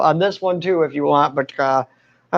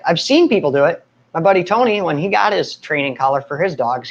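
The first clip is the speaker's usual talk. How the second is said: usual speaking voice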